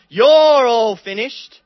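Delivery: loud, slow, aggressive "You're all finished" with "all" hit hard; vengeful threat. Shown as a demonstration. Preacher showing a vengeful misreading, loud and slow. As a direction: snarl it as a threat of revenge. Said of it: "not words of revenge and aggression".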